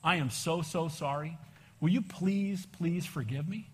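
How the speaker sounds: soft, humble, pleading; apologizing to the angry driver, soft and sincere; sincere apology. Retold or imitated. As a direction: say sorry from the heart, quietly. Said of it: "I go".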